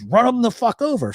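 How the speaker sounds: high, insistent repeat of the order; yelled order. Retold or imitated. Accomplice repeating his order to the driver, high and insistent. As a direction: push the order again, high and firm.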